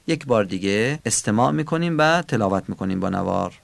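usual speaking voice

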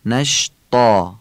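نشطا slowly, ط full and from the throat; heavy throaty ط. Shown as a demonstration. Teacher demonstrating the heavy letter. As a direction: give the ط in نشطا a thick throaty sound. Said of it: "حرف تا رو ... پر و درشت از گلو بگیم".

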